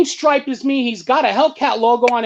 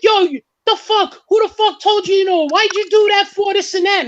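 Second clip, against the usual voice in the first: higher, heated mock yelling at the copycat; retold yelling; 9 semitones higher than usual. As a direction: yell at him like you're chasing him down. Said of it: "we start yelling at him".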